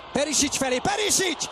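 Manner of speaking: high excited shout; excited name call. Real, not acted. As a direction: scream the name as he shoots. Chance called live.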